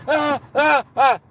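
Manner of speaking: high, loud grunted yelps; imitated struggling cries. Man squirming in cuffs, imitated cries of struggle.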